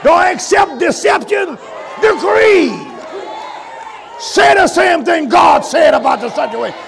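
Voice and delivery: loud, high preacher's cry; preaching at full force. Acted. Preacher urging the church, at full force. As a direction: drive the exhortation home like a pulpit call.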